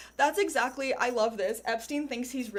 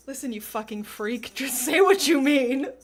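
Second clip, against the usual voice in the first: mock outburst rising to a loud yell; imagined professor losing patience, louder; exasperated yell; 2 semitones higher, 11 dB louder than usual. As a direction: blow up at him, loud. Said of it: "I love that he was like".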